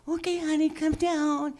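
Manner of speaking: high, coaxing voice as if to a pet; coaxing owner. Retold or imitated. Coaxing the cat down from the tree in a high, sweet voice. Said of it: "I'm like".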